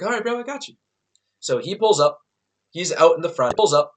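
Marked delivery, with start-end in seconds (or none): 0.0-0.7 higher, easygoing bro voice; a hint of a laid-back buddy; friend's voice. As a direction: play the chill friend saying he's got you. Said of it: "he was like".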